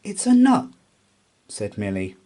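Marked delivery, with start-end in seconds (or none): 0.0-0.7 mouse voice. A narrator's reading. Milly points it out.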